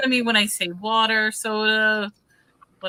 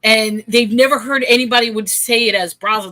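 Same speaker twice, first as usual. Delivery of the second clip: ordinary talk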